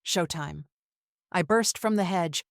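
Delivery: usual speaking voice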